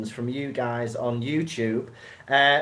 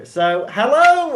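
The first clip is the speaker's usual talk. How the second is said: excited greeting; 10 semitones higher, 7 dB louder, slower than usual. Real, not acted. Big greeting to viewers.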